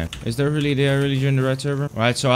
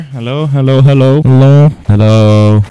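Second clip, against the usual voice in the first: calling out; 12 dB louder than usual. Real, not acted. Calling to another player.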